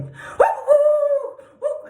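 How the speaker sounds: high, loud frantic woos; imitated dog yelping. Shown as a demonstration. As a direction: yelp like a panicking dog. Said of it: "it sounds like".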